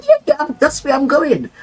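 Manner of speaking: high, emphatic reassurance; acted eager confirmation. Rasta man assures doubtful visitor of the lift, high acted eagerness. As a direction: confirm it keenly, pitched well up.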